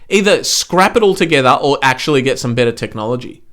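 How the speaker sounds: ordinary delivery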